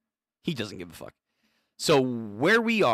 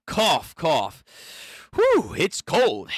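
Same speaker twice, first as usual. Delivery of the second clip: cold shivering character; 5 semitones higher, slower than usual. Acted. Voicing a freezing character.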